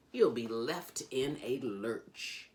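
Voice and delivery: plain reading voice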